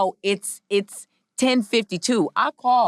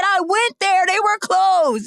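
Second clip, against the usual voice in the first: high, louder, fed-up repeat; frustrated insistence; 12 semitones higher, 5 dB louder, slower than usual. Retold or imitated. Speaker telling the UPS driver again, high and louder. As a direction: hammer it home, fed up.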